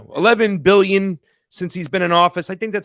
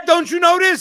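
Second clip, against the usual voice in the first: high, incredulous jab; mock scolding; 11 semitones higher, 3 dB louder than usual. Co-host mockingly scolding the host. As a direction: scold him with fake disbelief.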